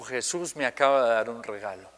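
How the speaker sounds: low, soft, lightly moved retelling; retold tearful words. Boy telling the preacher after prayer, mildly tearful imitation. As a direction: quietly imitate someone speaking through tears.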